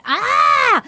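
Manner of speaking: short high mock yell of frustration; frustrated yell. Woman fed up with explaining, high frustrated yell. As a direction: let out an exasperated high "Ah!". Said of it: "she was making a lot of noises".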